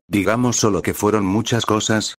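ordinary delivery

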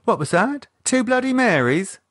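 brightened up. Acted. Michelle overhearing the drinks order, eager and bright. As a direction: perk right up at the word of drinks. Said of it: "Michelle brightened".

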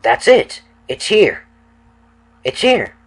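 excited. Acted. Sensing the vision arrive.

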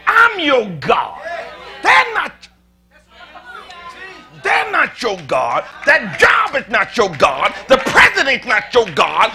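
loud, high preaching as God speaking; rising preacher's shout; full-force preaching. Acted. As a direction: preach it hard, God commanding the flock. Preaching God's words at full force.

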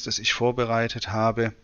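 ordinary talk

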